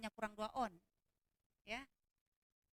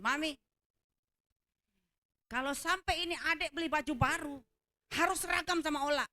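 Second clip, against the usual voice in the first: raised, louder mock ultimatum to mami; ultimatum pembantu; 3 semitones higher, 3 dB louder than usual. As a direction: imitate a maid laying down her demand. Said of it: "dia juga ultimatum saya". (ultimatum dengan nada tinggi, menirukan pembantu)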